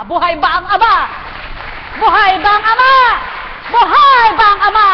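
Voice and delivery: high, loud, repeated preacher's cry; congregation's call, shouted at full force; shouting. Real, not acted. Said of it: "kung ba't sumisigaw ka?".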